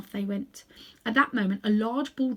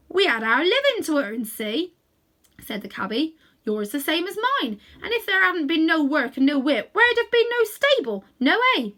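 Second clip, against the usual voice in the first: Cockney cabbie; 6 semitones higher, 8 dB louder than usual. Acted. Cabbie defends himself.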